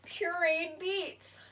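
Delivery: slow dramatic reveal; big reveal. Real, not acted. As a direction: unveil it slowly, proudly. Big reveal of mystery ingredient.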